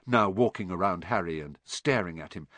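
usual speaking voice

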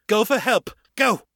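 high, urgent shouted command; shouted order. A narrator's reading. Morgan sending Elizabeth for help from the cell, shouted. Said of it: "Morgan shouted".

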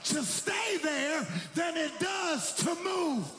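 high, sustained preacher's shout; full-force preaching. Real, not acted. Preacher urging the congregation on to another level, full-force preaching. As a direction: build to a soaring, rousing call to the congregation.

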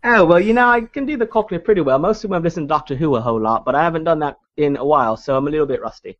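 put-on Cockney accent. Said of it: "what about your Cockney?".

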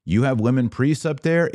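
normal talking voice

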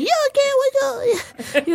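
put-on high little-kid voice; a child's high-pitched, concerned question. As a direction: play a small kid asking. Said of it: "like a kid".

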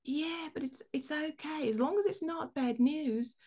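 put-on high, hushed, sleepy Australian accent; bad Australian accent. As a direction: imitate a drowsy Australian woman, badly. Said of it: "apologize for the bad accent".

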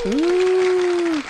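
high loud cheering whoop; excited whoop. Real, not acted. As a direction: whoop like the crowd just went wild. Cheering for a round of applause.

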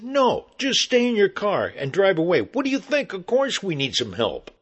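loud, high sarcastic outburst; angry yelling. Retold or imitated. Hairy man answering an offer of help, sarcastic yelling. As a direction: yell it with exasperated sarcasm. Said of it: "he's yelling at me".

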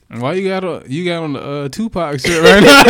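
loud, high teasing through laughter; laughing, teasing. Real, not acted. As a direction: roast him while cracking up. Teasing friend who is crying.

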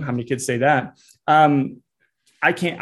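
ordinary talk, nothing marked

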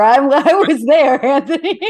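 real laugh breaking through the reply. Real, not acted. Host laughing to her guest about remembering video cassettes.

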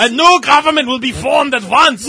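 hammed-up German accent. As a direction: bark it like a cartoon German dictator. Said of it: "read that entire thing in an accent".

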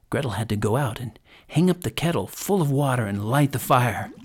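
ordinary delivery, nothing marked

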